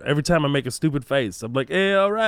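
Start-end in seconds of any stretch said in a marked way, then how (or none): 1.7-2.3 goofy reaction. Retold or imitated. Acting his stupid face moment.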